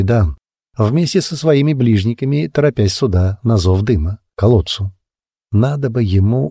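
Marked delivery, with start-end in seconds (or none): none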